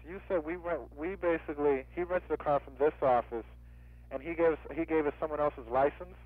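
normal talking voice

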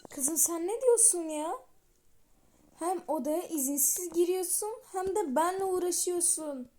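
put-on furious girlfriend scolding the rival; angry confrontation. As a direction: let her have it, indignant.

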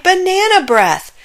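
high, loud comic outcry; disgusted cry. A narrator's reading. Dentist recoils from Zack's breath, high disgusted cry. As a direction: cry out in storybook disgust at the smell. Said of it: "he cried".

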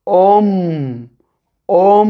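Om said as one syllable, O closing into a humming M; blended syllable om. Shown as a demonstration. Teacher joining O and M for pupils. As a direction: hum the M after the O. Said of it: "O derken yanına M ekleyelim".

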